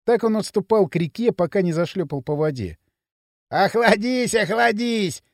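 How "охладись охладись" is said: high-pitched teasing call; mocking, as if enjoying the joke; amused jeer. A narrator's reading. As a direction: taunt with mild mirth. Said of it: "снова засмеялся Пакля".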